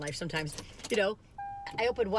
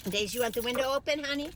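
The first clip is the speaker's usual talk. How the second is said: higher, louder sweet pet voice; talking to the dog; 6 semitones higher, 3 dB louder than usual. Real, not acted. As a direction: call the dog fondly, a little higher.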